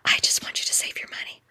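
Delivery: soft unvoiced whisper; hushed aside. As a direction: whisper it like a secret tip.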